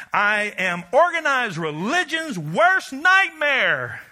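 slow, high and loud; preached shout. Acted. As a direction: fling it out at full force. Preaching peak.

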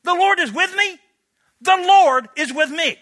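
high, loud, disbelieving echo of the words; incredulous repetition. Acted. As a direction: say it like you can't believe it. Gideon's doubting repetition.

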